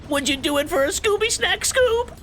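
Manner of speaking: Shaggy bribing Scooby with a snack. Pretend. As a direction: coax the dog like Shaggy.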